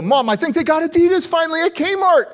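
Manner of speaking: put-on high, loud eager kid voice; excited kid. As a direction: burst out like a thrilled kid. Excited kid spotting shoes.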